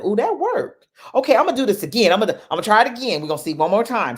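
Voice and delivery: high, loud burst of delight; thrilled surprise. Retold or imitated. Woman thrilled her plant grew a new leaf, excited. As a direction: squeal like it actually worked.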